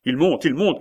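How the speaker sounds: high, loud warning cry; alarmed shout. A narrator's reading. Servant seeing soldiers on the stairs, alarmed shout. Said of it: "cria le serviteur".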